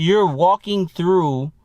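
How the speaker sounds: ordinary delivery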